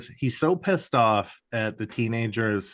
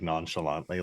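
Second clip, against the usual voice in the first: usual speaking voice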